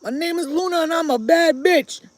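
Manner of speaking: put-on high, loud voice for a dog; brash boast, speaking as the dog; Luna. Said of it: "Tell everybody who you are".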